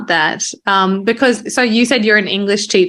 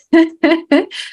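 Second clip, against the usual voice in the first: laughing; 8 semitones higher, 3 dB louder than usual. Real, not acted. Laughs after yes.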